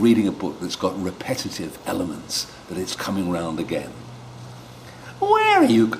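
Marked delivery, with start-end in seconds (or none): 5.2-6.0 much higher false start at the owl, cut off; a wrong, much higher try at owl, then stop. Acted. Reading to kids, wrong much higher owl voice. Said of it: "that's the wrong voice".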